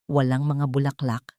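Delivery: plain reading voice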